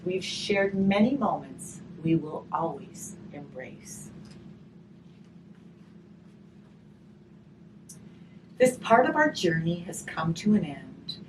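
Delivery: gentle storybook reading, slow and warm; reading to children. A narrator's reading. Teacher reading a grief picture book to children, gentle storytelling.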